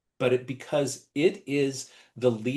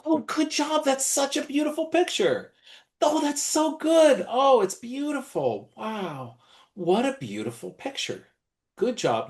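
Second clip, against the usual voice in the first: high, gushing grown-up praise voice, much higher; gushing praise to a child; 12 semitones higher than usual. Shown as a demonstration. Teacher gushing over a child's drawing, high sing-song praise. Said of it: "fast forward".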